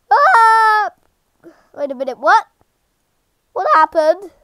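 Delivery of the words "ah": high, loud yelp; startled yell. Real, not acted. Player startled by the growing boss, loud yelp. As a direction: yell out in sudden alarm.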